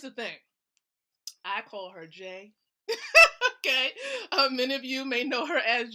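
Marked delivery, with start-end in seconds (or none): 2.9-3.6 sudden high, loud burst of laughter. Real, not acted. Laughs about the nickname.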